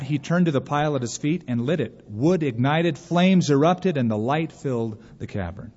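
usual speaking voice

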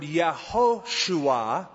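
"Yehoshua" sounded out as yeh-HO-SHOO-ah; Hebrew pronunciation. Shown as a demonstration. Preacher explaining the uncontracted name, Hebrew pronunciation of "Yehoshua". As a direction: give "Yehoshua" its full Hebrew syllables. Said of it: "a contraction of the Hebrew word".

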